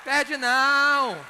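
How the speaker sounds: high, loud, very drawn-out shout; big slow punchline yell; emphatic holler. Pretend. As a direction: stretch the words out at full volume.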